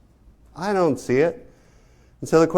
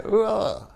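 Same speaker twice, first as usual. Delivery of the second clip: high, imitating a disgusted groan; mock disgusted ugh; 8 semitones higher, 4 dB quieter than usual. Lecturer imitating the painted woman's disgusted groan. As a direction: act out her squeamish recoil. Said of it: "She's doing this".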